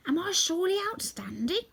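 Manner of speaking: high, eager Scarecrow asking to be praised. Acted. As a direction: play the Scarecrow fishing for a compliment, pitched high.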